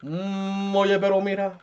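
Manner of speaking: long meditative hum into Spanish; hammed-up Buddha drone with Dominican slang. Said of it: "I'm Dominican Buddha".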